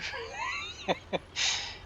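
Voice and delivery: breathy, wheezing laugh; real laugh. Real, not acted. Storyteller amused by his retold phone call, wheezy laugh. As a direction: laugh helplessly, mostly breath.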